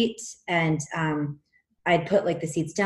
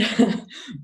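brief amused laugh mid-sentence; short laugh; 5 semitones higher than usual. Real, not acted. Telling the story of the confrontation, brief laugh. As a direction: let a small laugh slip out.

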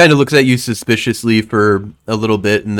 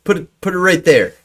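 louder, higher order; commanding NPC; 7 semitones higher, 4 dB louder than usual. Acted. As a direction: point the sword and command. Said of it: "he points the sword down ... and he goes".

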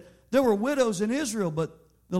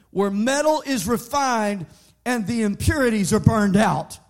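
preaching; 3 semitones higher, 6 dB louder than usual. Acted. Preaching at full force.

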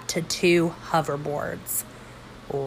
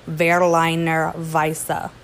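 "Berliner Weisse" in a slow light German accent, V sound; much slower than usual. Shown as a demonstration. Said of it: "excuse me if I sound German".

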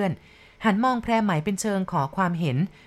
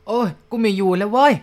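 panicked shout; 3 semitones higher, 7 dB louder than usual. A narrator's reading. Woman blown over by the storm, panicked loud yell. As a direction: screech that she's had enough. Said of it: "นางชะม้อยตะโกนลั่นอีก".

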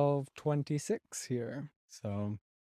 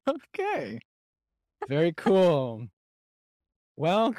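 goofy voice; 5 semitones higher, 8 dB louder, slower than usual. Acted. Odd put-on voice at sign-off.

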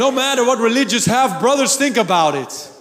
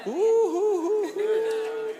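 excited ooh-hoo; 13 semitones higher, 5 dB quieter, much slower than usual. Real, not acted. Preacher's excited vocal outburst.

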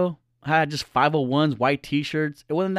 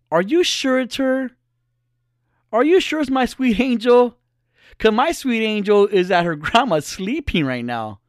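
hammed-up innocent lover; mock naive fiance; 8 semitones higher, 3 dB louder than usual. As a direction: play the clueless sweet boyfriend. Mocking naive trusting self.

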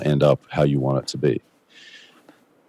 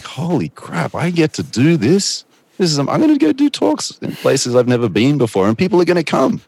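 much higher, loud, amazed; dramatic excitement; 11 semitones higher, 3 dB louder than usual. Retold or imitated. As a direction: gush like you can't believe your luck. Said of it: "aggressive and dramatic when I portray it".

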